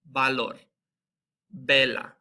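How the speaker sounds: "valor, vela" with the V said as a B, "balor, bela". Shown as a demonstration. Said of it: "it sounds just like a B".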